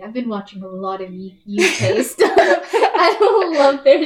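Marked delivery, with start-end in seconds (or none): 1.5-4.0 laughing loudly, slowed down. Real, not acted. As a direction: talk through real laughter. Laughing about UK slang.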